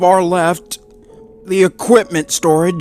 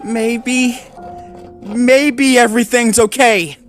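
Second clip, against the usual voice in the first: higher, hesitant self-soothing; meek reassurance; 12 semitones higher, slower than usual. Acted. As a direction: try to calm his own fear. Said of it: "meek attempt to ease his fears".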